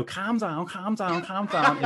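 hammed-up Liverpool calm down; Scouse catchphrase. Scouser doing the Harry Enfield catchphrase, mock Scouse. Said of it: "I am a Scouser".

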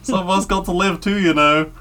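very high, loud cartoonish voice; comic film character. Singer plugging CDs in the gift shop, high comic plea. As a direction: plug it in a silly high-pitched voice. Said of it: "they're like".

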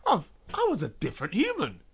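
mock disbelief. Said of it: "I'm thinking".

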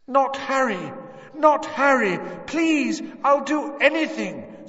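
high, loud frantic cries; desperate pleading scream. A narrator's reading. Mother begging for her son's life, desperate scream. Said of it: "his mother's voice was louder than ever".

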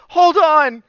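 high, loud mock-mom command; urgent interruption. Mom stopping her son to make calls, urgent interruption.

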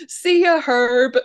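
a hint of tough kid bravado; gleeful goodbye. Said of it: "he would be able to say".

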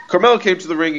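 ordinary talk, nothing marked

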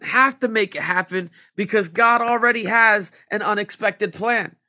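fervent preaching. Acted. Preacher rousing the congregation with Mary's story. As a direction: drive it home to the congregation.

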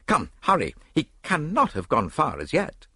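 rally him to give chase; urgent urging. A narrator's reading. Urging a chase after thief.

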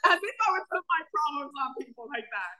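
hammed-up retold indignation; mock offended. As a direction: play up the wounded pride, laughing. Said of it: "I was, like, offended".

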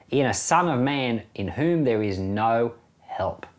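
normal talking voice

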